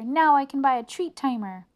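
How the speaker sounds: loud, high and eager; bursting with happy plans; excited. A narrator's reading. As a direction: Arthur still cheering loudly. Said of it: "cried Arthur".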